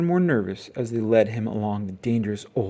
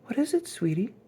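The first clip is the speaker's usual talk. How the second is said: gentle mother; 11 semitones higher than usual. Acted. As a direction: ask her gently, sweetie. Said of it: "asked Mama".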